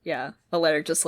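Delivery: ordinary delivery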